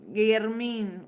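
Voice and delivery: normal talking voice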